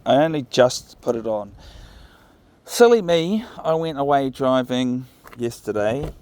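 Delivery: ordinary talk, nothing marked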